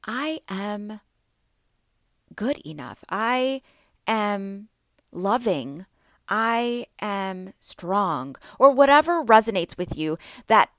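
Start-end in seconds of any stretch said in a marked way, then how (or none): 0.0-3.0 soft, slow, sincere I am good enough; positive affirmation. Shown as a demonstration. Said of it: "and then say". 3.1-8.4 positive affirmation. Shown as a demonstration. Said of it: "and then say".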